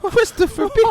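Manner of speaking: flirt high like a silly bear; mock Pooh. Pooh showing Christopher his tail, high cooing voice. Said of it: "acting as Pooh".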